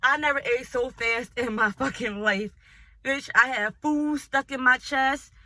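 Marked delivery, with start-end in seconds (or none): none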